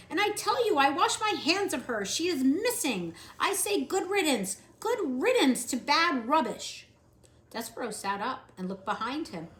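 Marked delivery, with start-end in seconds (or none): 0.1-6.9 loud, high and dismissive; shouting. Acted. Louise shouting good riddance about the missing maid. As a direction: shout it like washing your hands of her. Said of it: "Louise was shouting".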